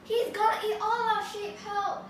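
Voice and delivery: high, loud panicked cries for help; shouting for help. Acted. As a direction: yell for the villagers from the hilltop. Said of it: "started shouting".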